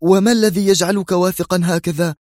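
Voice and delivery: high-pitched agitated outcry; exclaiming. A narrator's reading. Colleague challenging the brigadier's confidence, exclaiming. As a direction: cry out the question, pitched well up. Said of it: "هتف أسعد".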